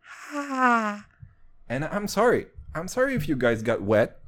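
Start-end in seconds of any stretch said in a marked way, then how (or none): 0.0-1.1 one long, high falsetto "haaa"; mating turtle cry. Retold or imitated. Doing joke ASMR for listeners, long turtle cry. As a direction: let out a single squeaky turtle moan. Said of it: "with the turtle fucking".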